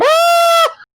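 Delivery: high, loud frightened scream; children screaming. Acted. Captured children screaming in fright, high and loud. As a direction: shriek like a scared group of kids. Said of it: "you hear a group of children scream".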